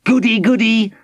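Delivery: high-pitched mocking taunt; sneering. A narrator's reading. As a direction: taunt like a teasing child. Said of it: "sneered Squidgy".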